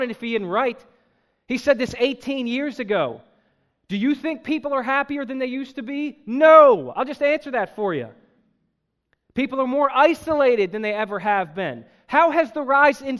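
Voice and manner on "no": emphatic no. Real, not acted. Answering his own question.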